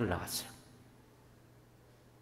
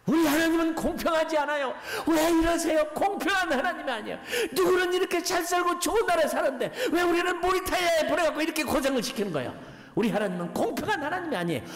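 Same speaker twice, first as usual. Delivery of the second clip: high-pitched retold outburst of a child; indignant, pushed-up voice easing toward the end; angry child's protest; 13 semitones higher than usual. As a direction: imitate an angry kid crying unfairness. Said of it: "막 화를 내면서".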